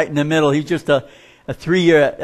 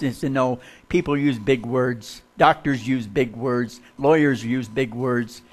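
normal talking voice

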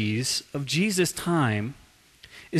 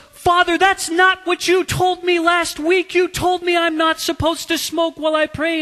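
high, loud outraged protest; hammed-up indignant rage; fit of rage; 12 semitones higher, 5 dB louder than usual. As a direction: protest in acted fury, voice pitched way up. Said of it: "in a fit of rage".

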